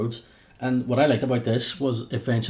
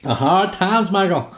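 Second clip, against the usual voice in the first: higher, louder Southern drawl; Dusty Rhodes; 8 semitones higher, 6 dB louder, slower than usual. Retold or imitated. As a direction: ham up a loud old wrestling promo. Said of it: "Dusty Rhodes".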